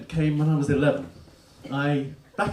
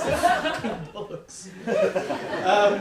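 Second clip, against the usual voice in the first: laughing; 8 semitones higher, much slower than usual. Real, not acted. Nervous self-mocking aside.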